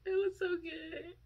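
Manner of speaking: high, soft crying voice; tearful. Real, not acted. Fan moved by the video, soft crying. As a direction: say it through tears.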